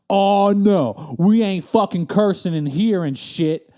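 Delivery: a clerk telling off a cursing customer, slow and loud; huffy clerk. Retold or imitated. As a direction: scold like an offended DMV clerk. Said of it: "that's what she said".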